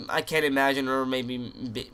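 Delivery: ordinary talk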